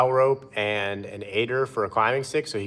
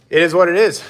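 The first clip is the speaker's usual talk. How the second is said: higher and louder, a shrugging declaration; loud resigned shrug; 7 semitones higher, 7 dB louder than usual. Real, not acted. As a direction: belt out the shrug.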